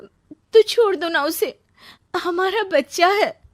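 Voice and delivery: high, loud, slow begging; desperate plea. Acted. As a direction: beg him to leave her for their child. Neeru begs ex-husband. (ऊँची, ज़ोरदार, गिड़गिड़ाती विनती)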